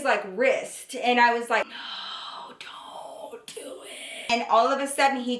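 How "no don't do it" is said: slow, voiceless whisper, acting out begging; whispered plea. Girlfriend holding his wrist as he proposes, whispered plea. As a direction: whisper it as a drawn-out, desperate retold plea.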